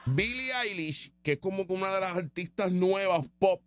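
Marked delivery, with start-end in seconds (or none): none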